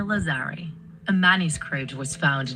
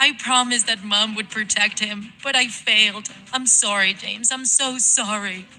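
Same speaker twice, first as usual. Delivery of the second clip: grieving, guilt-ridden plea; distraught mother apologizing; anguished apology; 3 semitones higher than usual. Acted. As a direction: beg your dead child's forgiveness. Mother apologizes to her dead son.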